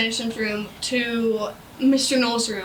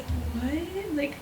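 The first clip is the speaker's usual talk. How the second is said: whispered confusion; 9 dB quieter, slower than usual. Retold or imitated. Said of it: "I'd be like".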